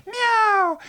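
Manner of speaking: very high, loud meow; cat meow. Acted. Teller meows as the waking cat, high meow. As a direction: mew like a cat.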